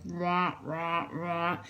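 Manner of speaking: imitating muffled cartoon grown-up babble, softly; soft wah-wah mimicry of distant talk; Peanuts characters. Imitating girls talking in the bathroom, softly wah-wahing. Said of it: "it sounded like Peanuts characters".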